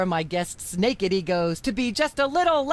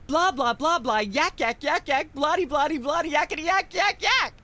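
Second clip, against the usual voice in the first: building rant; 6 semitones higher than usual. Acted. Talk show host ranting about pop music, climbing to a shrill peak. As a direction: wind yourself up into a frenzy of nonsense.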